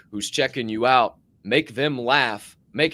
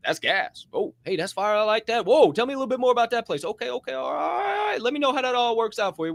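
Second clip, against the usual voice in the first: higher and higher, excited encouragement; hyped friend; 11 semitones higher than usual. Retold or imitated. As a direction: gush like a friend hyped on your idea. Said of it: "And they say".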